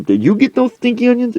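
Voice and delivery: high-pitched, hammed-up questioning; pestering demand. Coach accosting a woman, high pestering demand. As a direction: interrogate in a pushed-up voice.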